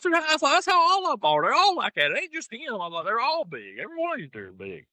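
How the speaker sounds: telling his cousin every deer up north is big; high mock explaining voice. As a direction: mock-patiently insist all of them are big. Himself retold, pitched way up.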